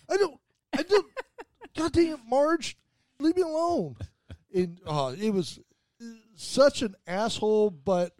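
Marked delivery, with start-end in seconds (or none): 0.1-4.0 higher, far whiny grumble; imitating a cranky old barfly begging to be left alone; whiny Steve. Steve pleading with the bartender Marge, mock whine. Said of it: "And he's like".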